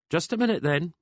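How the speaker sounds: high, lilting woman's phone voice; trilling. A narrator's reading. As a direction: trill it up high and sing-song. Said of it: "she trilled".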